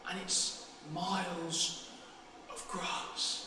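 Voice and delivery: very soft, slow, dreamy; wistful. Acted. Boy wishing he were a cowboy, wistful and hushed. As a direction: drift off into the daydream.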